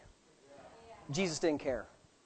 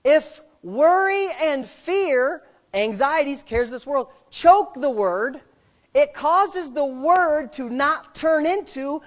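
slow, louder, rising preaching; full-force preaching; 4 semitones higher, 6 dB louder, slower than usual. Acted. Preacher teaching the congregation about faith, slow loud preaching. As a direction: drive each phrase home slowly.

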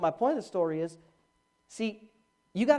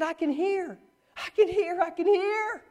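imitated high cry; 10 semitones higher, slower than usual. Preacher replaying healed girl's words, high contained cry. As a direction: cry it up high without full force. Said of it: "she's yelling".

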